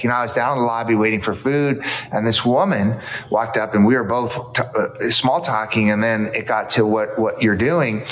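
usual speaking voice

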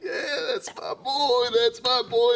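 high, slow, proud cheer of a dad. Retold or imitated. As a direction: cheer like a dad bursting with pride. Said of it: "And he's like".

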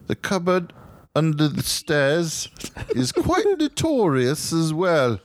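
put-on slow, high British accent. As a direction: read it like a British homeowner. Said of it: "I should give the British accent".

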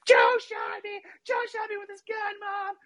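put-on high falsetto kid hollering for Mom; squealed tattling, very high-pitched; screaming. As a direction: imitate a little brother wailing as he runs. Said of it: "screaming at the top of his lungs".

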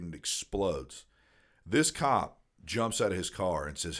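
ordinary talk

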